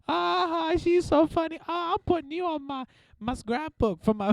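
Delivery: shrill mocking impression of laughing moms; mocking Disney moms. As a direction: squeal like a delighted tourist mom. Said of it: "the moms going to Disney World were like".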